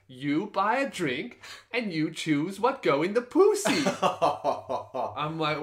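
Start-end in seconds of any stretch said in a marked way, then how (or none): 0.1-3.8 lightly mimicked cheery Thai hostess, higher pitched; happy Thai bar girl. Bar girl explains the deal to a customer, happy imitation. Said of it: "really happy goes".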